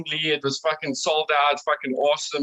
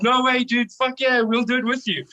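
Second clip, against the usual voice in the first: hyped agreement; 7 semitones higher than usual. Retold or imitated. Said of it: "And we were like".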